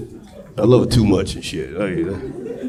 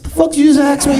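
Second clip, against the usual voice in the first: incredulous; faster than usual. Retold or imitated. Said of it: "I said".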